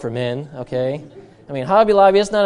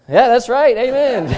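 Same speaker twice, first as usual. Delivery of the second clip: higher, louder amen with a laugh; 7 semitones higher, 6 dB louder than usual. Retold or imitated. Pastor agreeing with a stranger in a store, a loud laughing amen. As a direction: cheer him on like a preacher. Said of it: "and I said".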